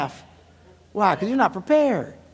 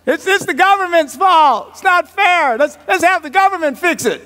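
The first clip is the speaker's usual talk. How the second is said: high, indignant complainer; hammed-up high-pitched grievance; mock whining; 9 semitones higher, 4 dB louder than usual. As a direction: protest like a sore loser. Mimics people blaming the government.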